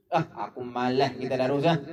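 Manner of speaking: put-on low, sulky old man; lazy sulky grumble. Old man refusing tadarus, low sulky grumble. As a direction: grumble it like a reluctant old villager.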